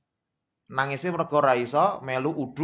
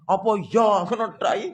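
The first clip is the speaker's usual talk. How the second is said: high-pitched dismissive scoff; raised, incredulous mock dismissal; mock scoffing retort; 8 semitones higher, 5 dB louder, faster than usual. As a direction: mimic a stubborn sceptic waving it away. Stubborn fool brushing off proofs.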